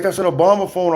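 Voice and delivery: high, loud mocking gasp over a flip phone; mocking the viewers who call him broke, high and loud; mocking gossipers. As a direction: play the shocked gossip, voice climbing. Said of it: "they were like".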